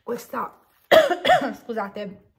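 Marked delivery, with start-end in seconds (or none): 0.9-1.6 sudden sharp coughing fit; choking cough. Real, not acted. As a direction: cough as if saliva went down wrong. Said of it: "mi è stata la saliva di traverso".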